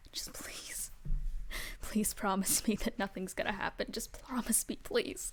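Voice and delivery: whispered, desperate pleading; begging whisper. Acted. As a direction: beg in a breathless whisper. Marcel begs after quarrel.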